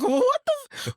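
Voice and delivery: high, shocked what the f; shocked outburst. Retold or imitated. Queen retells hearing the casting pitch, jumps up in shock. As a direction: jump up high in disbelief. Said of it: "I was like".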